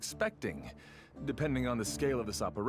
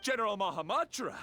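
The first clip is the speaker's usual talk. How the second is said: contained shout; 9 semitones higher than usual. Acted. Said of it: "Keep your voice down".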